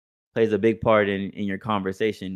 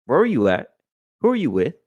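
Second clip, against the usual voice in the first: raised, higher voice, a boy playing the parent; kid scolding mom; 5 semitones higher, 4 dB louder than usual. Little boy grilling his mom who came home late, mock scolding. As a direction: demand answers like a strict parent. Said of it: "He's like".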